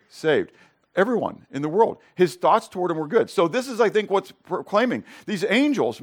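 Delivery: usual speaking voice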